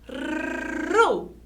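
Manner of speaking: RU opening on a long rolled R; trilled R. Shown as a demonstration. Teacher showing pupils the R trembles, trilled. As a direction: roll the R before U in "ru".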